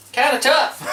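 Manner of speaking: high, breaking into a laugh. Real, not acted. Cook admits ribs are tough, laughing. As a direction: laugh it out as the meat fights back.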